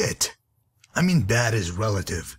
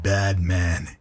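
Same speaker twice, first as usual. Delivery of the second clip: ordinary talk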